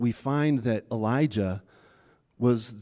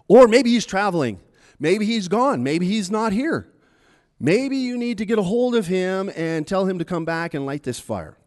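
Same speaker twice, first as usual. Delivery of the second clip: mocking taunt; 6 semitones higher, 5 dB louder than usual. Taunt resumed loudly.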